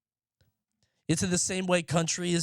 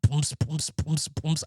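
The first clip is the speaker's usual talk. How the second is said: mock German techno fan; slower than usual. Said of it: "It's just".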